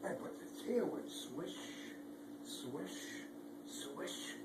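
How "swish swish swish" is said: soft swish. Acted. Tail swishing sound.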